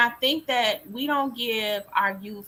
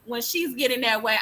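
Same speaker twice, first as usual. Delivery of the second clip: ordinary talk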